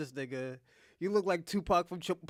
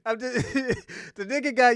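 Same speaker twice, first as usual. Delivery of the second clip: higher, louder words through laughter; laughing; 5 semitones higher, 8 dB louder than usual. Real, not acted. Excusing the laughter to friends, still laughing. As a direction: fight the laugh to keep explaining. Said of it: "I'm not laughing at domestic violence".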